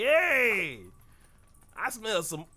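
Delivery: high, loud pestering bat voice; mock bat voice. Roommate bats pestering the player, high and loud. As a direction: squeak it like a nosy bat. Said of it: "these bats like".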